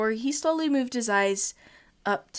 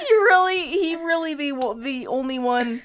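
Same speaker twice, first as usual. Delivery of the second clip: laughing; 6 semitones higher, 6 dB louder than usual. Real, not acted. Reading fanfic while laughing.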